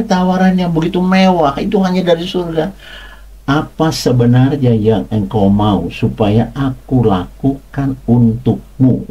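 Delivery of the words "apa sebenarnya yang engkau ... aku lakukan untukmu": slow, unhurried offer from God; preacher voicing God's gentle slow question. Shown as a demonstration. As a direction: let each word of the question land slowly. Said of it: "sampai saya ngomongnya pelan seperti itu".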